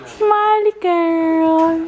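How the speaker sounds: high, slow cooing. Real, not acted. Cooing to baby.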